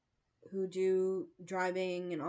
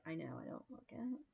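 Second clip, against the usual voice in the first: unvoiced, softer confiding whisper; 3 semitones lower, 11 dB quieter, faster than usual. Real, not acted. Joking to viewers about her age, whispered. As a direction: hush it like a secret.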